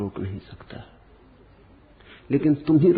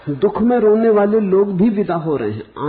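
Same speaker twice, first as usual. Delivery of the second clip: louder and higher, preaching with full force; ringing emphasis on भी; forceful proclamation; 8 semitones higher, 7 dB louder than usual. Real, not acted. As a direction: declare it to the whole hall, higher and louder. Repeated line, first forceful.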